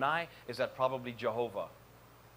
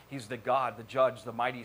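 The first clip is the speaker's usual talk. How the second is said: normal talking voice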